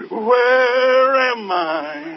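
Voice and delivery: very slow, drawn-out lost voice; comic imitation of a dazed patient; dazed amnesia patient. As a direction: stretch every word like someone who has lost all sense of place. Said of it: "she'd walk around with her arms in front of her saying".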